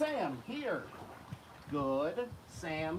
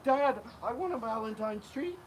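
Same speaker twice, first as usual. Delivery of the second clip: begging dogs voice; 7 semitones higher than usual. Retold or imitated. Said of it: "They're like".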